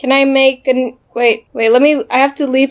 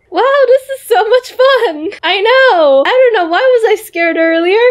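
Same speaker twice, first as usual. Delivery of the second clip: high excited toy chatter; high doll voice; 9 semitones higher than usual. Acted. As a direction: pitch up, playful doll. Doll dialogue continues.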